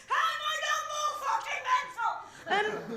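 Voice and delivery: high, strained mock-crying voice; exasperated wail. Daughter after six weeks with parents, exasperated high wail. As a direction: wail it high, hammed-up despair without extra volume.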